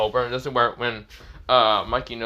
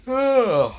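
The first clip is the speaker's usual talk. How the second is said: loud, high groan; waking groan; 12 semitones higher, 6 dB louder than usual. Acted. Draken waking from a deadly wound, groaning loudly. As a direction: groan as if coming round.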